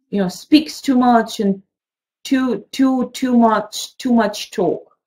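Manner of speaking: usual speaking voice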